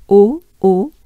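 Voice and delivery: loud closed O. Shown as a demonstration. Teacher modelling the closed O clearly. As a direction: push out a tight closed O. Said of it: "pretty closed up O".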